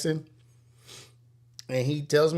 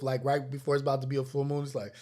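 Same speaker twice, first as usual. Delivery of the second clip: ordinary delivery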